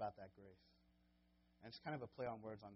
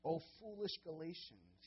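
slow, louder, higher scolding cry; exasperated rebuke; 6 semitones higher, 6 dB louder, slower than usual. Retold or imitated. Paul rebuking the Galatians, a slow exasperated cry. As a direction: scold them as foolish, dragging it out. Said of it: "he says".

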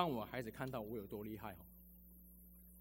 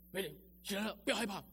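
retold urgent call; 8 semitones higher than usual. Urging his daughter to get up.

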